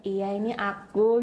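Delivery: slow, drawn-out gentle answer; changed, demure speech. Retold or imitated. Reformed woman assures office friends it's her, demurely. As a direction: imitate a newly well-mannered way of talking. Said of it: "Cara ngomongnya juga udah berbeda".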